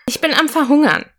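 put-on a little girl's dramatic complaint, called out; calling out. A narrator's reading. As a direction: announce your hunger to the room. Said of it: "rief seine Schwester".

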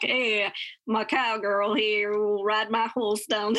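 put-on slow, drawn-out cowgirl Southern accent. Mother imitates her daughter's random accent, slow Southern. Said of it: "a Southern, like".

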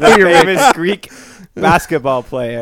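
slow, loud sports-intro voice; mock announcer. Host introducing the famous player, as a mock announcer. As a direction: announce the player grandly, drawn out.

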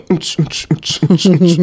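rhythmic pulsing mouth noise; imitated throbbing. Shown as a demonstration. Comedian mimicking his sloshing brain, pulsing noise. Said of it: "feel it behind my eyeballs going".

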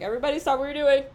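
loud, fast, high mock announcement; hammed-up call for attention. Mock public announcement in the workplace.

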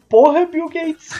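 slow, laughing exclamation. Real, not acted. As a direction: laugh through the words. Joking about Bill Gates.

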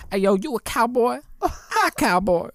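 loud, high, hammed-up cowboy accent; mock a northerner playing cowboy; goofy fake cowboy accent, shouted out; bad cowboy accent. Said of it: "they went to Texas and they're like".

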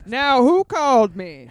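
loud slow call. Acted. Lawyer's loud entrance.

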